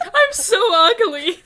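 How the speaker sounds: loud, high, drawn-out self-pity; hammed-up lament, slow and loud; mock wail. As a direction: wail it like a drama queen. Voicing a character's self-pity.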